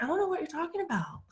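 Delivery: playing dumb. Playing dumb to a sarcastic person, mock-innocent and laughing. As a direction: act clueless and giggle it out. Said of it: "pretend you're Pamela and play dumb to it".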